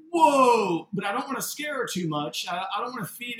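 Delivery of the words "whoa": loud, high whoa; retold astonishment. Father reacts to the red lady, retold loud astonishment. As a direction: re-enact a startled outburst. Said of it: "And I'm going".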